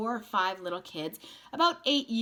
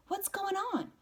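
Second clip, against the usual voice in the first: put-on boy's voice. A narrator's reading. Narrator asks a grown-up.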